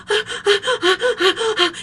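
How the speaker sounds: high gasping mock sobs; acted child's hiccuping wail; imitated crying. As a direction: sob in quick wordless bursts.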